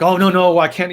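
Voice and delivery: playful "oh no" refusal; acted protest.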